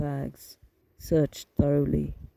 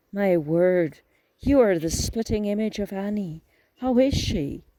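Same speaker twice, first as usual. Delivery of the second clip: higher, louder, amazed at the likeness; delighted surprise; 4 semitones higher, 5 dB louder than usual. A narrator's reading. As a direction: gasp with joy at Annie's double. Aunt sees the nephew's likeness.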